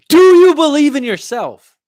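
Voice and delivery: high and loud, demanding an answer; challenging shout. Real, not acted. Coach shouting at viewer to believe in himself, high and loud. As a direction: belt the question at him.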